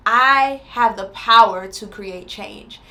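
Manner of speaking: slow, deliberate declaration; slow affirmation. Acted. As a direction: plant each word firmly. Repeats affirmation slowly.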